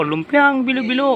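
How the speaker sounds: slow, high sing-song; vendor call. Retold or imitated. Imitating the vendor's call, slow and high. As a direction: stretch out the vendor's cry. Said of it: "nakarinig ako".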